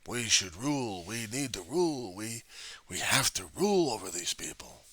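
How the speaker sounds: lightly mocking, self-important decree; pompous ruler voice. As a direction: mimic a haughty would-be ruler. Mocking power-hungry conservatives.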